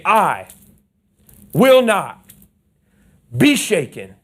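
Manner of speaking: triumphant declaration. Shown as a demonstration. Pastor leading the congregation in a bold declaration. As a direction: proclaim it like you've been rescued. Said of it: "I need you to say it like you have been redeemed".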